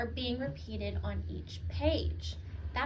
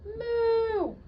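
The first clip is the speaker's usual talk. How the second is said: high, drawn-out moo; playful high moo; 8 semitones higher, 4 dB louder than usual. Acted. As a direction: moo like a cow. Dog makes cow sound.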